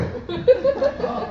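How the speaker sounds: high burst of laughter. Real, not acted. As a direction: laugh high at your own punchline. Comic laughs at own joke.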